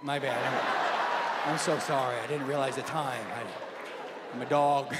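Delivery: low, sheepish dog voice; apologetic dog. Acted. Dog answering the yelling neighbor, sheepish. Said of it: "Like, the dog's down there going".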